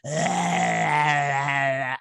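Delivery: mock creature growl-cry; mock monster cry. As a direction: imitate the eerie growl. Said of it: "you hear her growling".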